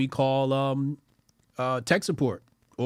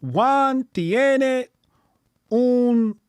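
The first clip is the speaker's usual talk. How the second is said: language app voice; 12 semitones higher, 8 dB louder, much slower than usual. Retold or imitated. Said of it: "Then it said".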